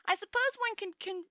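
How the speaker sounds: high voice, musing aloud; little man dreaming of other worlds; thoughtful little man. A narrator's reading. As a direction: think it through in a high eager voice. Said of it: "said the little man thoughtfully".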